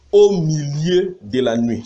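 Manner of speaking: slow, drawn-out proclamation; emphatic verse reading. Acted. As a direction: read it out slowly, leaning on each phrase. Reads verse, then has it underlined. (lecture appuyée et lente du verset)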